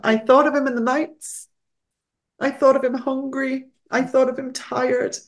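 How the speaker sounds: fighting back tears as she speaks; near tears. Real, not acted. Guest recalling the wilderness of Jesus, close to crying. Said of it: "I'm getting very emotional".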